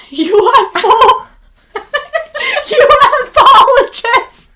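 slow, high, laughing through it; can barely get the word out for laughing. Real, not acted. Laughing fit over the word.

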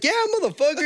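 high, laughing yell of confirmation; mock triumphant retort. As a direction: rub it in, voice way up. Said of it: "I was like".

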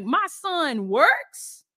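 slow, drawn-out disbelief; incredulous father asking; amazed question. Retold or imitated. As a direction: stretch it out, stunned. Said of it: "God be like".

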